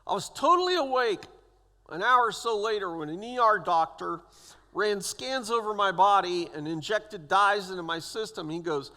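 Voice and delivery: ordinary talk, nothing marked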